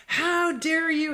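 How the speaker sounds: high, drawn-out outraged cry without full volume; imitated screaming mom. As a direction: mimic a yelling mother, slowly. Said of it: "she kept screaming and yelling".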